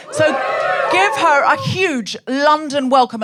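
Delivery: rally the crowd slowly; announcer hype. Real, not acted. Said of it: "host hyping crowd".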